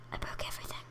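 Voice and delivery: breathless hushed admission; mock-tragic whisper. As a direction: whisper it in guilty shock. Whispered after yell.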